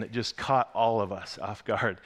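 ordinary talk, nothing marked

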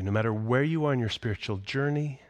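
usual speaking voice

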